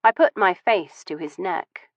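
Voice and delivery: usual speaking voice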